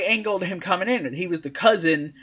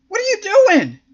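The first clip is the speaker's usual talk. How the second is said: high, loud incredulous outcry; appalled disbelief; 5 dB louder than usual. Retold or imitated. Host reacts to a wrestler's antics, loudly appalled. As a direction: exclaim it in exaggerated disbelief.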